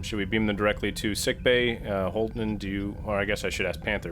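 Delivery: usual speaking voice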